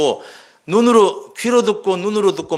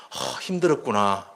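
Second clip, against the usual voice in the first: soft, lower, slow echo of the feeling; empathetic echo; 5 semitones lower, 5 dB quieter than usual. Shown as a demonstration. Listener echoing a friend's hurt, soft and slow. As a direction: gently give the words back. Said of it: "그 사람이 끝말을 반복하라".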